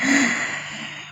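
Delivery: soft trembling exhale; breathy overwhelmed sigh; long breathy sigh. Retold or imitated. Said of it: "I'm like".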